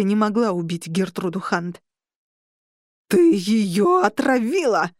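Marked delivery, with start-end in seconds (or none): 3.1-4.9 loud, slow growl of accusation; snarled. Acted. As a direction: snarl it out like a beast. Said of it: "прорычала колдения". (громкий медленный рык)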